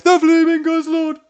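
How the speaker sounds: big announcer yell. Pretend. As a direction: bellow it like a wrestling announcer. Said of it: "If I go like".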